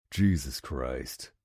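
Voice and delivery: slow, low, soft groaning curse; groaned. A narrator's reading. Hawk giving in to the hungry boy, slow low groan. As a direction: drag it out with a groan. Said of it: "I groan".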